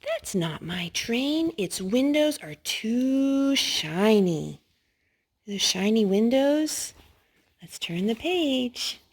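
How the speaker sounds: slow, sing-song picture book read; lively read-aloud for little ones; reading to children. Acted. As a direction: read it slow and bright for a toddler. Reading board book to toddler.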